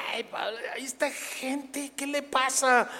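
high, hushed aside in dismay; imagined Bernabé. Acted. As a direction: play a bewildered companion, softly and high. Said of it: "Y Bernabé". (aparte agudo y bajito, desconcertado)

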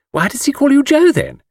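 put-on high, girlish teasing question; coquettish admirer. A narrator's reading. Miss Taylor quizzing Mr Cade, coquettish. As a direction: press him playfully in a high voice.

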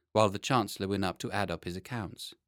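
usual speaking voice

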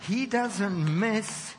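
slow, loud and high, each word weighed; emphatic punchline. Acted. Preacher driving home his point to the congregation, slow and emphatic. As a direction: land it like the final word of the sermon.

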